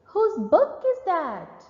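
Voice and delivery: rising question tune; questioning rise. Shown as a demonstration. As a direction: lift the voice for the question. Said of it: "Let's practice the pitch when there is a question mark".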